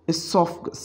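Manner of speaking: esophagus said with a silent O, starting on the E. Shown as a demonstration. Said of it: "ओ को हम बिल्कुल खामोश".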